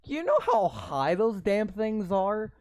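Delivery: loud incredulous rant; exasperated outburst. Real, not acted. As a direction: shout it like the premise is absurd. Mocking the game's story.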